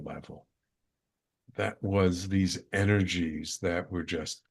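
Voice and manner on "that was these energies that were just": plain reading voice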